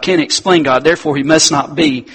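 usual speaking voice

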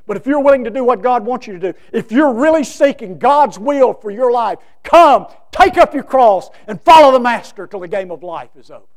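starts firm, climbs high and loud, slowing on take up your cross; building preacher's call. Acted. Preacher urging the congregation to follow, rising to full force.

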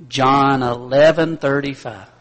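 "John eleven thirty-five" spoken slowly and loudly, word by word; slow, loud recital. Shown as a demonstration. Preacher drilling the congregation on the address, slow and loud. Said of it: "memorize the address for me".

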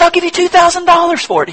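high, pushy eager buyer; eager offer. Acted. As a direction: blurt out the offer, dying to own it.

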